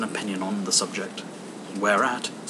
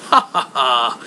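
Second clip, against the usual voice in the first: louder hearty laugh; 5 dB louder than usual. A narrator's reading. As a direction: keep laughing, full of glee. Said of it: "laughed Scrooge's nephew".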